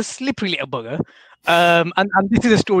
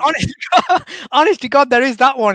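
laughing; 9 semitones higher, 5 dB louder than usual. Real, not acted. Laughing at the Yoda impression.

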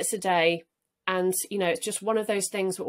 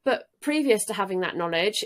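ordinary delivery

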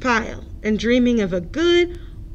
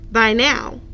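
usual speaking voice

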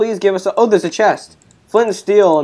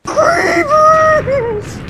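very high-pitched shriek; high, drawn-out yell; panicked game scream; 4 dB louder than usual. Real, not acted. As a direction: scream the warning out. Creepers attack in the game.

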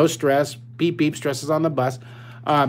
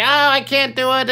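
put-on whiny frustrated kid; 9 semitones higher, 4 dB louder than usual. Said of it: "roll up your paper and say".

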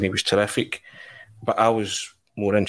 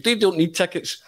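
higher, grand dismissive star; 9 semitones higher than usual. Retold or imitated. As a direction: wave it off like a host.

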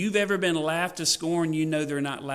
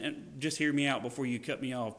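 ordinary talk

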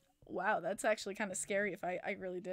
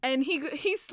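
ordinary talk, nothing marked

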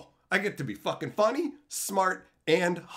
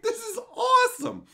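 delighted quote; 4 dB louder than usual. Retold or imitated. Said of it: "I'm like".

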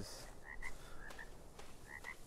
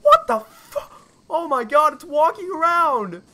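1 startled scream; 11 semitones higher, 10 dB louder than usual. Real, not acted. Sudden monster scare in game.

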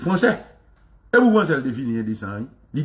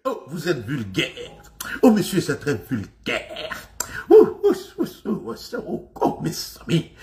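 prissy French snob. Retold or imitated. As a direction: play the scandalised prude clutching pearls. Mocking a prim Frenchman again.

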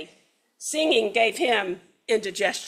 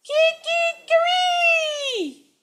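high, loud, slow sung rooster call; rooster crow; 5 dB louder, much slower than usual. Acted. Hungry rooster still singing, a long crow. And he did.

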